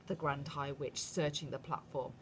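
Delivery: plain reading voice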